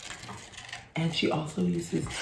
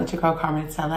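normal talking voice

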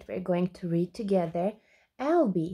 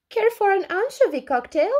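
cheery penguin; 11 semitones higher, 4 dB louder, faster than usual. A narrator's reading. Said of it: "came a voice".

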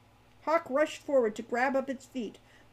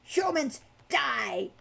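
slow, drawn-out creature threat; furious kobold; 3 semitones higher, much slower than usual. A narrator's reading. Captive kobold wriggling in Falcon's arms, furious. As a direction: curse them in a monster voice. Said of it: "began to wriggle furiously".

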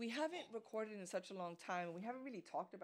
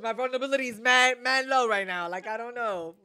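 much louder, half-joking outburst; loud mock lament about having no guard; mock loud complaint; 3 semitones higher, 18 dB louder than usual. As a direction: blurt it out, hammed-up exasperation. Jokey mock exasperation.

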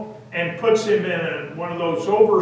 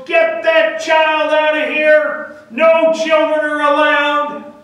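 loud, high shout, slowly punched out; head nurse's bellow; 8 semitones higher, 6 dB louder than usual. Acted. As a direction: bellow an order across the ward. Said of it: "she bellows".

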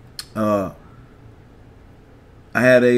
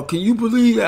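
high, stunned disbelief of a grieving friend; distraught friend; 10 semitones higher than usual. Retold or imitated. A grieving friend calling about the coach's death, high disbelief. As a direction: ask it high, like you cannot take it in. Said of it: "He all in distraught".

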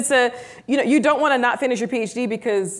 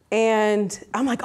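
ordinary talk

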